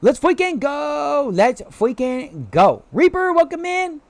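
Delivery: high, stretched-out celebratory cheer; hyped welcome. Real, not acted. Streamer cheering Reaper into the stream, hyped. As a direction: drag out the cheer, then pitch the greeting high.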